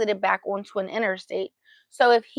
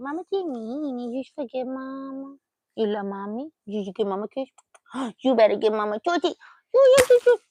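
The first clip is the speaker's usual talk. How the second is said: doting pet talk; 3 semitones higher, faster than usual. Real, not acted. Owner begging her dog for a kiss, doting baby talk.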